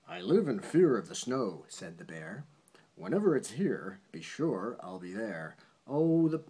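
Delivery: bouncy rhyming read-aloud; reading a poem to a child. Acted. Parent reading a bedtime poem to a child, sing-song read-aloud. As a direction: lilt the rhyme for a child at bedtime.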